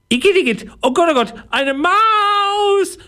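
very high, piercing squeal of horror; horrified shriek. A narrator's reading. Passenger finding a mouse in the bag, horrified shriek. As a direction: shriek it high, stretching the last word. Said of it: "schreit das Gesicht".

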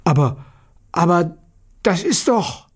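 halting astonishment climbing high; astonished. A narrator's reading. Aunt sees the changed Petja and calls out astonished. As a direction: break off in rising disbelief. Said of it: "rief erstaunt".

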